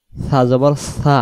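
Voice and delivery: slow সা জবর সা with soft lisped s; slow Arabic letter demo; soft tha. Shown as a demonstration. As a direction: lisp the সা gently. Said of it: "তিন অক্ষরে মাখরাজ তিন রকম".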